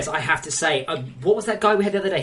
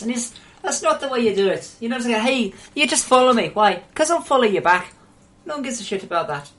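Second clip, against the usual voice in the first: lightly put-on, higher Irish accent; 6 semitones higher than usual. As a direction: tell him off in a mock Irish accent. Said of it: "my Irish accent's perfect".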